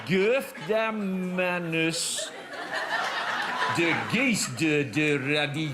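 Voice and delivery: very slow, drawn-out attempt at South African; South African accent. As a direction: labour through a mock South African accent. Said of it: "he's made it South African".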